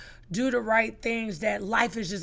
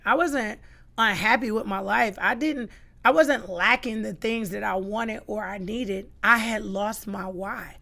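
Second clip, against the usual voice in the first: usual speaking voice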